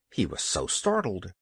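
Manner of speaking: ordinary delivery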